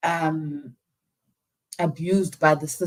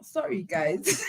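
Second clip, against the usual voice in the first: laughing through tears; 9 semitones higher, slower than usual. Real, not acted.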